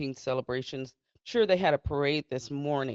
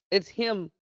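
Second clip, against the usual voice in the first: normal talking voice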